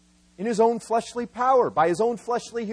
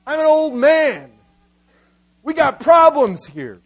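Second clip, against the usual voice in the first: raised, emphatic complaint with falling endings; mock protest; 5 semitones higher, 9 dB louder than usual. Old man objecting to God's promise, loud protest. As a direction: protest each point loudly, leaving pauses between them. Preacher voices Abraham's objection to God.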